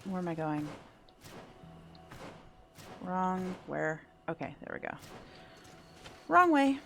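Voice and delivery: ordinary talk, nothing marked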